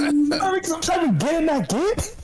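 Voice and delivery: high protest. Real, not acted. Fired coder protesting to the joking boss, high and half-laughing. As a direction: plead your case in mock outrage.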